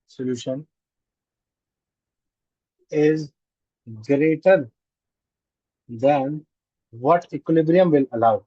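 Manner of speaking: word by word, long pauses, rising ends; slow dictation. Real, not acted. Teacher dictating a solubility conclusion to the class, slow dictation. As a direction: dictate slowly in chunks for note-takers.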